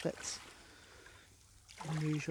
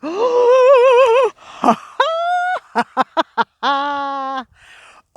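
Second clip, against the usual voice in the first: loud, high astonished gasp; huge gasp of delight; 21 dB louder than usual. Real, not acted. Beachcomber spotting a real marble, loud thrilled gasp. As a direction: gasp as if striking treasure.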